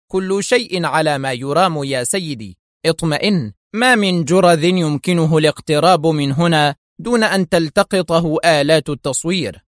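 ordinary delivery

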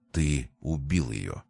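slow, low, soft accusation; hushed accusation. A narrator's reading. Brother accuses Price of killing her, hushed and slow. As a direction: drop it low and draw it out quietly.

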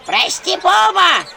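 high, loud wailing plea; howling. A narrator's reading. Mitrishna howls an apology, wailing. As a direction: bawl the apology. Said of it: "ревела Митришна".

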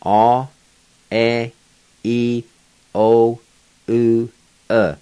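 six vowels one at a time, each held long; drawn-out, isolated Cherokee vowels; vowel sounds demo. Shown as a demonstration. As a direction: sound out "A, E, I, O, U, E" separately with pauses. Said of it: "here are the six Cherokee vowel sounds".

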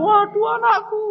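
high startled cry of "Waduh, anakku"; alarmed parent. Acted. Parent seeing their own child fall, alarmed.

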